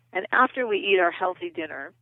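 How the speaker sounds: usual speaking voice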